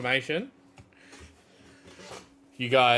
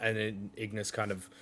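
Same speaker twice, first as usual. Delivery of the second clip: ordinary delivery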